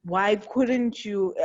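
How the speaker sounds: ordinary talk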